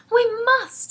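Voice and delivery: put-on high, loud insistence from a girl; girl crying out urgently. A narrator's reading. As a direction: cry it out, high-pitched and insistent. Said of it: "Sophie cried".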